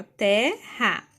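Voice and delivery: "terra" slowly as te - rra, pause between; separated syllables. Shown as a demonstration. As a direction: break "terra" into two beats. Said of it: "nós vamos separar assim".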